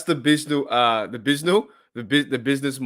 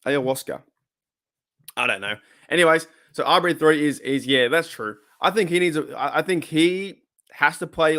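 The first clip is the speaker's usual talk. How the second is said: ordinary delivery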